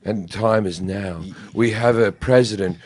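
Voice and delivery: low, grave doom-laden political ad read; lightly mock a gloomy billionaire ad; morbid ad voice. Mocking Tom Steyer's gloomy CNN ad, low and somber. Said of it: "where he goes".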